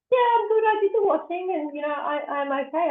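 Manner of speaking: high-pitched imitation of mum, easing down; imitating mum's reply. Daughter retelling mum's answer on the phone, high imitated mum. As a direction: start way up high, like mum insisting she's fine.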